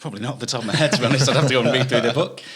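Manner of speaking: a sheepish laughing dodge. Real, not acted. Laughing when the host asks him for an example.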